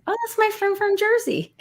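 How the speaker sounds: very high, playful voice; high-pitched quote. Retold or imitated. Answering friends who asked about the guy, high-pitched. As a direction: pitch it way up, as a light explanation. Explaining the friend to amazed guests.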